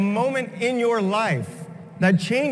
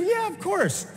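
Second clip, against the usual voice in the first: a much higher, lighter, breezy "yeah, of course"; cocky retort; 11 semitones higher, 4 dB quieter than usual. Retold or imitated. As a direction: toss it off, totally unbothered. Retorts to friends' mocking.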